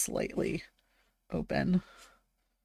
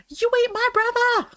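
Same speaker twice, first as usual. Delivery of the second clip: high, wounded little vegetable voice; an accusing radish, pitched way up; talking radish voice. Pretend. Radish stares at you while eaten.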